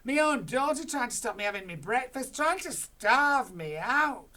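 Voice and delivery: Grandma shouts at her daughter; shouted. Acted. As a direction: feel betrayed. Grandma rants on.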